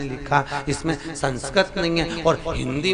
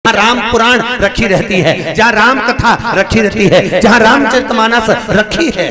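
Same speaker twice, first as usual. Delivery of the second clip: higher, louder rolling sermon; full-force preaching; 6 semitones higher, 11 dB louder than usual. Acted. Preacher rouses the crowd, higher and louder. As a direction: preach it out to the hall.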